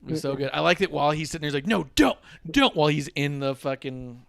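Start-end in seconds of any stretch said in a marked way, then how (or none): 1.7-2.8 high, loud pleading imitation; mock panicked protest. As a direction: plead to be let go, hammed-up. Imitates Rollins protesting during swing.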